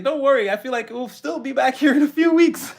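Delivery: loud, high-pitched teasing jibe; playful put-on voice about to crack up; mock reassurance. Voicing Divine teasing, then laughs.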